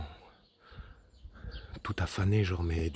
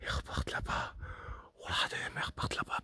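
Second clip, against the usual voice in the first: hushed unvoiced whisper; 8 dB quieter than usual. Real, not acted. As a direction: whisper so nothing hears you. Whispers while hunting the presence.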